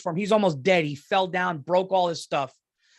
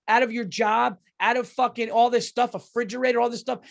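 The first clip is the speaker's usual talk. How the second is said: normal talking voice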